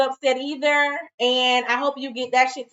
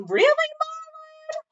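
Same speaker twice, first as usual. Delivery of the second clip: mock Flo disbelief. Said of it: "And Flo was like".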